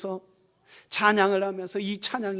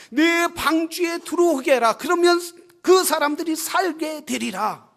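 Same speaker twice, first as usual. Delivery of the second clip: loud, raised voice of God; God commanding Noah; 5 semitones higher, 10 dB louder than usual. Acted. God charging Noah to save the people, commanding. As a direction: proclaim it high and strong, as a divine command. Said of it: "하나님께서 뭐라 했어요?".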